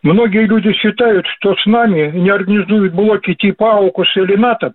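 ordinary talk

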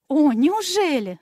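high, loud and drawn-out exclamation of feigned amazement; mock surprise. A narrator's reading. Fairy teasing the boy, exclaiming in mock surprise. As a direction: gasp it out, playing astonished. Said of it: "воскликнула она".

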